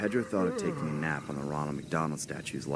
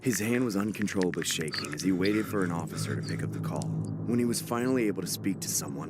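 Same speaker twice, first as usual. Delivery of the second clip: ordinary delivery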